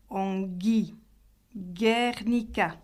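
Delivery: "Ongi, Guernica" with hard g as in gué. Shown as a demonstration. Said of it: "Le G se prononce toujours dur". (« Ongi, Guernica » : g toujours dur)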